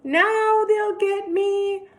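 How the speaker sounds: high, loud sung wail; cried. A narrator's reading. Anthony flying into the air, high sung wail. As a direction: wail it as he flies through the air. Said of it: "he cried".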